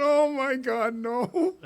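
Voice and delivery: high, half laughing dismay; dismayed oh my God. Retold or imitated. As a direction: groan at the disaster, laughing. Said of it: "And I went".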